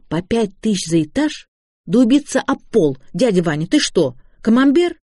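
ordinary delivery

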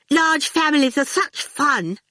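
high-pitched forced brightness; brightly, masking annoyance. A narrator's reading. Miss Potter, left out, needles Dillion, falsely bright. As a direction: trill it with pointed cheer. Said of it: "said brightly, obviously annoyed at being left out".

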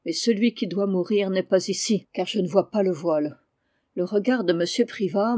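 plain reading voice

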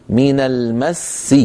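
slow من المس, the doubled seen released into a short i; two seens joined. Shown as a demonstration. Teacher shows that المس has two letters when joined, doubled seen. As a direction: hold the double s of المس and carry on into i. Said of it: "هو حرفان".

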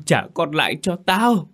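mother repeats her demand, now wailing. A narrator's reading. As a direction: cry it out rawer the second time. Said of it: "vừa gào vừa rằng".

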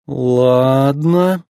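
drawn-out, wary "okay"; one long, dragged-out word; slowly. A narrator's reading. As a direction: stretch the word out hesitantly. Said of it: "медленно произнёс гонщик".

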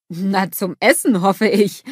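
ordinary delivery

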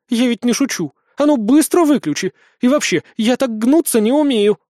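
high-pitched, agitated scolding; indignant command. A narrator's reading. Witch ordering the boy to switch it off, indignant. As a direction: order him sharply, flustered and cross.

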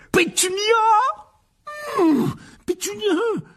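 frantic high bellow for his wife; strained, stretched-out enraged yell; roaring. A narrator's reading. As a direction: roar the name while grappling. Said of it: "rugit l'oncle Vernon".

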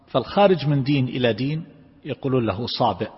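usual speaking voice

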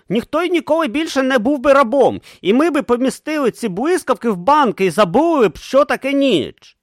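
high, excited inventor voice; eager young inventor. A narrator's reading. Urn dreaming of taming lightning, high and eager. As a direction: dream out loud, high and bright. Urn's visionary speech.

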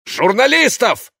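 very high, loud, drawn-out bark. A narrator's reading. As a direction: bellow the word in fury. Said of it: "рявкнул мэр".

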